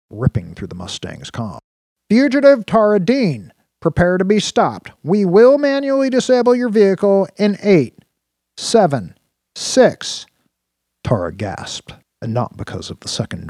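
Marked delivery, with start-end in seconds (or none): 2.1-10.2 loud, high machine announcement; automated drone voice. A narrator's reading. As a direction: count down like a robot. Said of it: "The drone's automated voice returned".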